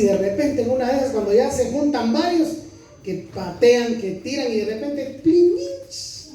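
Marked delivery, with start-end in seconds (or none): 5.2-6.3 high sharp mock crash noise; crash sound effect. Preacher imitating a flowerpot smashing, sharp crash noise. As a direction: make the pot-breaking sound.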